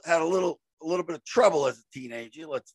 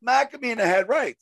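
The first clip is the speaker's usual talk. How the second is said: ordinary delivery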